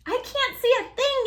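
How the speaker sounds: high, loud panicked yell. A narrator's reading. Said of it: "yelled Pip".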